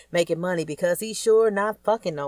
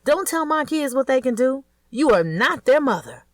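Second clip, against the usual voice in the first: higher and louder, furious mother; angry scolding; 8 semitones higher, 6 dB louder than usual. A narrator's reading. Georgia scolding the new wife over her kids, angry and loud. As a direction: tell her off hard.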